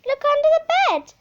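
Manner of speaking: a thrilled cat mum; excited mother cat. A narrator's reading. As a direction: burst out with the news. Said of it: "Sock said".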